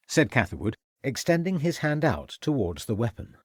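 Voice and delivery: ordinary delivery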